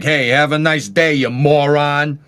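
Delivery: loud, slow "you moron" in a mock New York voice; mock New York greeting; New Yorker. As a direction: play a brash New Yorker tossing a friendly insult. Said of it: "in New York, they're like".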